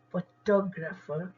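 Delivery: Photographer slowly, with TOG stressed; photographer said as fuh-TOG-ruh-fer; stress on second syllable. Shown as a demonstration. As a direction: lean on the ट of photographer. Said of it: "ट पे stress ज्यादा है".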